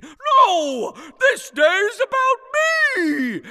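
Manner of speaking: very high, drawn-out Santa roar; indignant shout. Acted. Fake Santa shouting down Jesus in a staged quarrel. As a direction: shout it like an outraged, self-important Santa.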